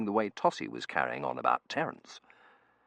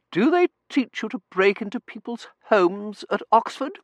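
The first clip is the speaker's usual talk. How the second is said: haughty lady voice; 9 dB louder than usual. Acted. Mrs Meering character voice.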